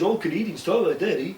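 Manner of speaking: mock Canadian accent from an American show; overdone Canadian accent. Shown as a demonstration. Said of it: "they really overdo the Canadian accent".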